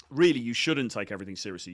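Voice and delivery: normal talking voice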